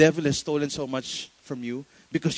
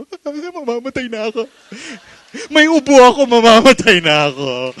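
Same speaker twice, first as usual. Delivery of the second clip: hammed-up panic, loud and high; mock panic; 8 semitones higher, 13 dB louder than usual. Preacher mocking a hypochondriac googling symptoms, hammed-up loud panic. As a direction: wail it like a hypochondriac who just googled a cough.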